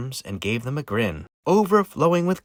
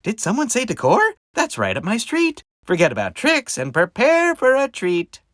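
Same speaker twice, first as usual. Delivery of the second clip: high, loud, gleeful; cheery little pumpkin; 9 semitones higher, 5 dB louder than usual. Acted. Christopher hearing about decor, gleeful and high. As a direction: chirp with eager delight.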